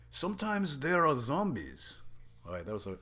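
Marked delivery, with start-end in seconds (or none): 0.1-1.9 put-on Wakanga's accent, slow and higher; wrong character's accent. GM slips into the wrong NPC's voice. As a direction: slip into Wakanga's accent by mistake. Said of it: "that was Wakanga's accent".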